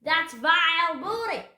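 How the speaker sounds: outraged captain at full voice; pirate roar. A narrator's reading. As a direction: bellow it in pirate disgust. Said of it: "roared Captain Bones".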